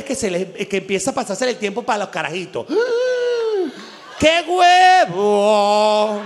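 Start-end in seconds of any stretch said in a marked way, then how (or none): 2.7-3.8 high startled gasp; shocked gasp. Acted. Mock shocked gasp at biological clock. 4.2-6.2 long drawn-out loud cry with a laugh; stretched-out exclamation. Acted. Mock outrage at ticking clock.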